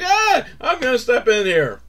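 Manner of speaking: high, tipsy announcement; a lightly voiced drunk; mock drunk guy. Drunk guy stepping toward the water, mock drunk. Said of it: "he's all drunk".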